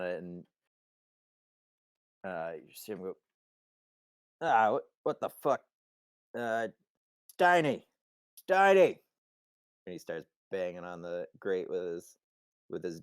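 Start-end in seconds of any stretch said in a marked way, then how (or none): 4.4-6.7 voiced higher and louder, thwarted; raised-voice exasperation in character; annoyed outburst. Simon pushing on the stuck grate, annoyed. 7.4-9.0 loud, high holler up to someone; calling out. Acted. Simon yelling up through the grate, calling out.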